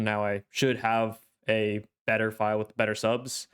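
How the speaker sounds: ordinary talk, nothing marked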